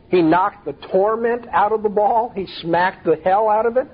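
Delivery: lifted, questioning endings on "ball" and "it"; puzzled literal question. Acted. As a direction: query it as if taking it literally. Mock-questions the literal meaning.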